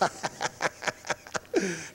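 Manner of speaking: breathy, prolonged snicker; acted wheezy cackle; mocking laugh. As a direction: snicker quietly at their failure. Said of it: "he's sitting over there laughing".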